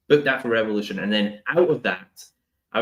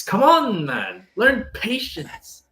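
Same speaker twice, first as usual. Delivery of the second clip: higher, drawn-out come on man; exasperated plea; 13 semitones higher, 4 dB louder, much slower than usual. Real, not acted. Telling impatient fans to wait, exasperated high slow plea. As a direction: plead with fans, slowly and much higher.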